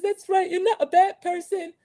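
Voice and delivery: high put-on accented voice; Martha reassuring. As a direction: imitate a dramatic friend giving comfort. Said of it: "trying to do their accents and these loud, horrible arguments".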